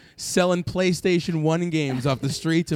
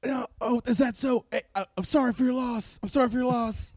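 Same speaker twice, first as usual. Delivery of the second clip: Bill Clinton voice; 5 semitones higher, 4 dB quieter than usual. Acted. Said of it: "I'm losing the Bill Clinton voice".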